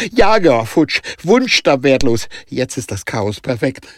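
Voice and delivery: high, loud dismay fading at the end; horrified. Acted. Danica lamenting the lost car, high horrified voice. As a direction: list the losses in horror. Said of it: "fragte Danica voller Entsetzen".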